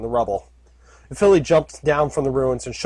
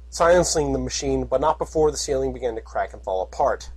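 ordinary delivery, nothing marked

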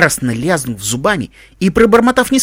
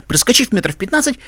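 plain reading voice

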